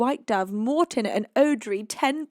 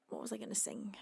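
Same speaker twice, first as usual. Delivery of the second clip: whisper; 2 semitones lower, 16 dB quieter, faster than usual. Real, not acted. Whispering to herself, mid-stream.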